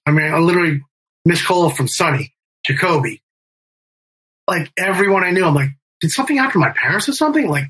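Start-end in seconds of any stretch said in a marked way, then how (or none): 6.0-7.5 high, fast, worried blurt; panicked retelling. Retold or imitated. To himself, seeing all the missed calls, high fast panic. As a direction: rush it out like you fear the worst.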